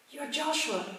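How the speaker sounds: high, sudden realisation; a retold gasp of recognition; sudden high surprise at hearing his name; surprised recognition. Stranger realises who he's met.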